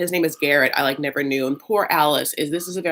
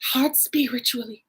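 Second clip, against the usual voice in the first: Southern mom; 9 semitones higher than usual. Retold or imitated. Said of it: "She's like".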